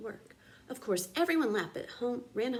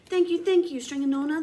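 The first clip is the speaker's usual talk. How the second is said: high, loud relieved thanks; grateful cry; 5 semitones higher, 7 dB louder than usual. A narrator's reading. Townspeople thanking Stringanona, relieved cheer. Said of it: "the people cried".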